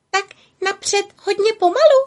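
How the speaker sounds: very high, timid asking; tiny beetle voice. A narrator's reading. Beetles ask Pytlík timidly, high small voice. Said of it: "ptali se Pytlíka".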